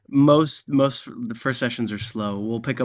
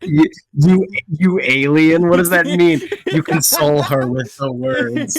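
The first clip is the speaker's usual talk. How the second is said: amused disbelief; 5 semitones higher, 8 dB louder than usual. Real, not acted. Teasing disbelief among laughing friends.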